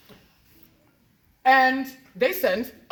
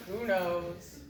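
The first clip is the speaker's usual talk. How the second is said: normal talking voice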